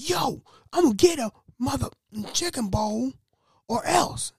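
high squeaky put-on customer voice threatening; mock customer threat. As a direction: play the customer demanding his bowl in a high squeak. Customer threatens over chicken bowl.

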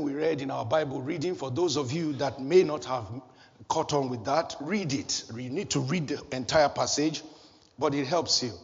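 ordinary delivery

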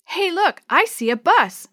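high, loud sighting; excited calling out. Acted. Maria spotting a bus through the trees, excited call.

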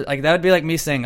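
normal talking voice

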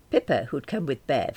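ordinary delivery

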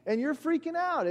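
high, bewildered, spelling it out; exasperated explanation. Retold or imitated. Telling the panicking roommate why it's normal, exasperated. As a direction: explain the obvious, baffled.

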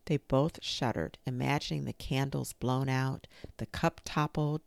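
plain reading voice

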